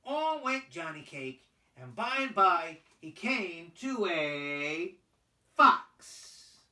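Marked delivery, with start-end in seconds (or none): none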